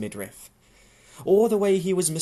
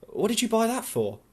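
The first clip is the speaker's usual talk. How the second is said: put-on raised young woman's voice, pressing the question; Louise voiced higher; 10 semitones higher than usual. Louise presses Tom about the red wine, voiced higher. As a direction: press him, higher and pointed.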